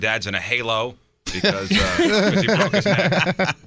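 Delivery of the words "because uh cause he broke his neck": high, drawn out with laughter; real laughter running through the words; laughing while talking. Real, not acted. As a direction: break up laughing on the punchline. Cracks up at own joke.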